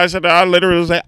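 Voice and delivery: usual speaking voice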